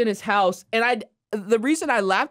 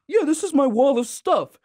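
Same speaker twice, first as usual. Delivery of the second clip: imitating Homer; 6 semitones higher than usual. Retelling Homer's photo wall, higher proud Homer. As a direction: show it off proudly. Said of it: "Homer's like".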